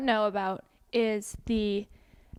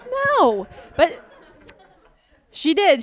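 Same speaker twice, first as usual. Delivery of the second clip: emphatic; 5 semitones higher, 8 dB louder, slower than usual. Real, not acted. Emphatic reveal.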